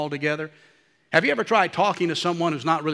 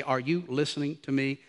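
low, soft, deliberate question; quiet parental insistence; 4 semitones lower, 6 dB quieter than usual. Retold or imitated. Parent holding a distracted child's face, quietly insistent. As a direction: drop the voice to hold a child's attention.

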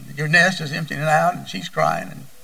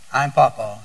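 usual speaking voice

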